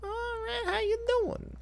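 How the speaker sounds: very high squeaky Leno voice; Jay Leno impression. Streamer imitating Vinny imitating Jay Leno, very high squeak. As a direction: squeak every line like a cartoon talk-show host. Leno bit resumes in game.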